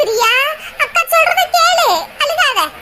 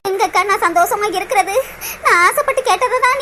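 lower, hushed and broken; despairing; 4 semitones lower than usual. Acted. Priya asks how she can ever be happy, despairing. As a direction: let the hope drain out.

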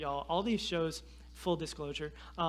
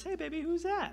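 lightly voiced wife; 11 semitones higher than usual. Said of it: "she goes".

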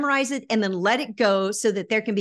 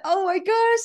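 surprised inner thought; 9 semitones higher, 5 dB louder than usual. Retold or imitated. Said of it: "I thought".

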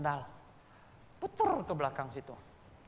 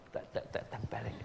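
usual speaking voice

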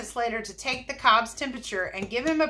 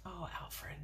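hushed, unvoiced whisper; very soft murmured comment under the breath; whispered aside; 3 semitones lower, 20 dB quieter than usual. Real, not acted. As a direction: whisper a small reaction to the story. Quiet aside about the character.

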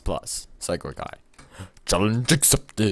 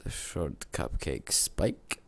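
whisper; 6 semitones lower, 14 dB quieter, much slower than usual. Real, not acted. Reading quietly to himself.

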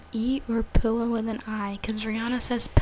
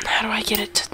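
hushed phone show and tell; whispering. Real, not acted.

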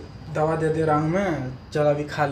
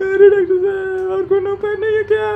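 hammed-up high wailing sob; mock sobbing; 4 dB louder than usual. Patient begging the doctor for medicine, hammed-up high sobbing. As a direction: wail it much higher, crying comically. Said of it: "रो मत, रो मत".

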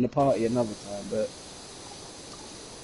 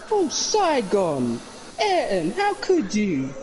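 high, slow, hammed-up dismay; mock dismay; 8 semitones higher, slower than usual. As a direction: stretch it out like a betrayed friend. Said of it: "We'd be like".

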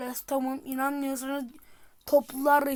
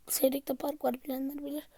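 normal talking voice

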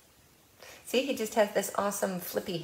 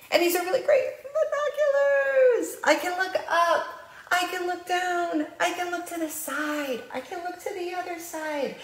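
high, bright, laughing pretend-play voice; playful storytime; 11 semitones higher than usual. Librarian pretending with toy binoculars for kids, high playful.

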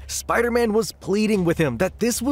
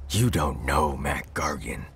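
very low, softer villain voice; Scorpion replying; 5 dB quieter than usual. A narrator's reading. Reeling Scorpion refusing to give up, replying deep and low. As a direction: drop deep and quiet for the villain. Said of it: "the Scorpion would reply".

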